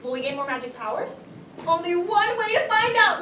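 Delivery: ordinary talk, nothing marked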